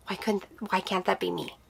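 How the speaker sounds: very soft, halting, close to tears; quiet hurt. Real, not acted. Woman hearing friends' pregnancy news, quietly hurting. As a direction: let the question out softly, with real hurt. Grieving, voices her painful thought.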